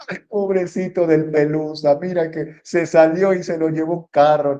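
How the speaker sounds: mother breaking the news of the dead cat, half-hearted weepy voice; mother starting to cry. Retold or imitated. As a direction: lightly act a mother's teary wail over the lost cat. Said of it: "la mamá empieza a llorar".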